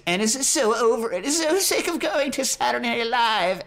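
high, exasperated complaint. Real, not acted. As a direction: rise in mock exasperation. Exasperated exaggeration.

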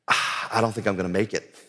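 low, soft voice after a heavy sigh; sigh, then quietly give up; weary, breathless. Retold or imitated. Husband confiding to his wife during lung issues, weary and breathless. Retells gasping out fear while ill.

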